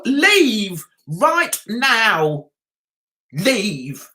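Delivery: very slow, drawn-out order; stern dismissal. Pretend. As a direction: stretch each word to send the troll packing. Ordering a troll out.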